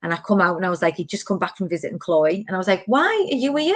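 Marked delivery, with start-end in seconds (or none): 2.9-3.8 teasing. Retold or imitated. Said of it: "like, taking the piss".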